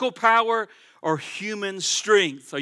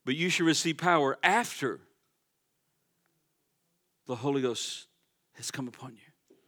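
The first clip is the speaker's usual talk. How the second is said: hushed reading; 6 semitones lower, 7 dB quieter than usual. Real, not acted. Preacher quietly reading the KJV verse to the church, hushed. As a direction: drop to a near-hush, lower, letting it fade out.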